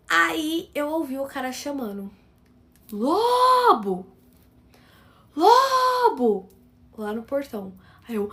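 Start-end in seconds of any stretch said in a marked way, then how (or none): 2.9-4.1 calling out loudly. Retold or imitated. Delivery man calling at the gate. 5.3-6.5 a higher, louder repeated call toward the house; calling out loudly. Retold or imitated. Delivery man calling at the gate.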